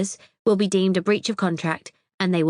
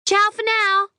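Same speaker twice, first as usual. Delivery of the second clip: very high, louder, sing-song ciao; tinkly sign-off; 5 dB louder than usual. A narrator's reading. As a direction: trill the goodbye brightly. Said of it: "a tinkly voice says".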